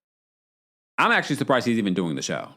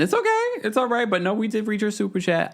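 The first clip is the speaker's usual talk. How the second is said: reassuring; 11 semitones higher than usual. Real, not acted. Reassuring viewer who stepped away.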